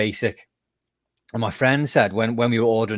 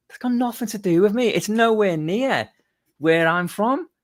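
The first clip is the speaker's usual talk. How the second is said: much higher, incredulous mock outrage; indignant retort; 12 semitones higher, 4 dB louder than usual. Brit retorting to Canadians about an Australian coworker, indignant. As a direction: protest in a high, baffled voice.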